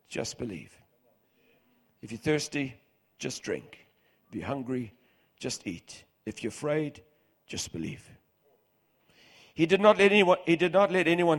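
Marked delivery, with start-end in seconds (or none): 0.1-8.1 soft reassurance. Acted. Jesus reassuring the synagogue ruler.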